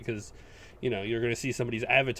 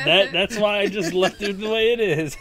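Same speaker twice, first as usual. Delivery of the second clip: laughing; 5 semitones higher, 6 dB louder than usual. Real, not acted. Joking about odd uses.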